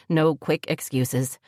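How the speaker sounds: plain reading voice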